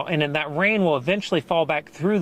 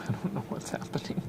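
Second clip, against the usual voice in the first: hushed, near-whispered aside to self; low, soft and absent-minded; puzzled self-talk; 3 semitones lower, 9 dB quieter than usual. Real, not acted. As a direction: puzzle it out under your breath. Puzzling over equipment to self.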